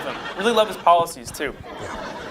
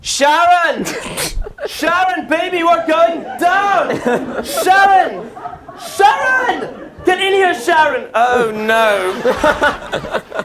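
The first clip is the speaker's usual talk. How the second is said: [name]; 9 semitones higher, much slower than usual. Pretend. Rock star flying a crashing plane calls his wife, hammed-up panicked yelling. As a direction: cry out for your wife in a drawn-out, high mock panic. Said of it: "what I got from [name]".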